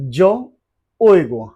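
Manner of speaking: very slow, each syllable drawn out; slow drill. Shown as a demonstration. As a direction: teach it slowly for repeating. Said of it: "চলেন শুরু করি". (ধীরে ধীরে উচ্চারণ)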